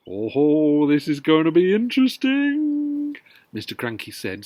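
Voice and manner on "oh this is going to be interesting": grinning. Acted. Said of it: "still grinning".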